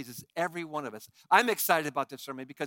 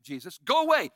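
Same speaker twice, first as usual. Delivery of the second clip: demon crying out; 4 dB louder than usual. Acted. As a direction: hurl it at Jesus, crying out. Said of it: "cries out at the top of his voice".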